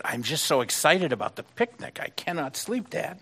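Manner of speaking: a hint of a breathless child begging his dad; excited little boy. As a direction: play the kid who can't sleep for excitement. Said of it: "little boy said".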